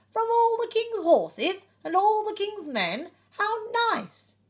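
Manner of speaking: high, loud, delighted egg voice; Humpty Dumpty's delight. A narrator's reading. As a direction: gush like a thrilled Humpty Dumpty. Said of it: "he thinks".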